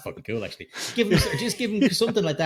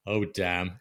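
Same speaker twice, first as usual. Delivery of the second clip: deadpan pilot; 5 semitones lower, 4 dB quieter than usual. Retold or imitated. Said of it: "bad guy pilot turns around and goes".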